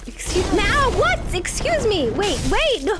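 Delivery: high, loud startled protest; indignant alarm. Real, not acted. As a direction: cry out as if ambushed mid-game. Startled by attack in game.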